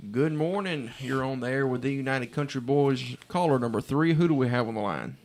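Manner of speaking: loud, upbeat on-air caller greeting; radio contest promo. Real, not acted. Answering winning caller on air.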